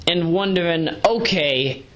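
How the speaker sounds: ordinary talk, nothing marked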